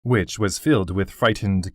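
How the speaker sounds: usual speaking voice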